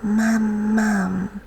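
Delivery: a hint of a baby saying Mum; baby calling Mum. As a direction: coo it like a toddler's first word. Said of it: "she said".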